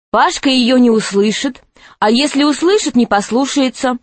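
ordinary delivery

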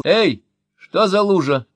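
high, loud, slow alarmed call; shout from the doorway. A narrator's reading. Daughter shouting from the doorway, loud and high. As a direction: yell across the room at the puddle. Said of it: "закричала она с порога".

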